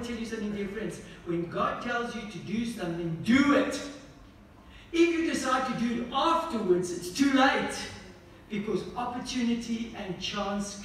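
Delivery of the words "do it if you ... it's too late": raised, louder preaching voice that drives each point home; fiery preaching. Acted. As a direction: preach it out loud to the congregation. Preaching at full force.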